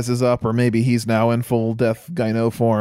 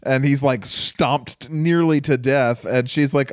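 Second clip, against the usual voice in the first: ordinary delivery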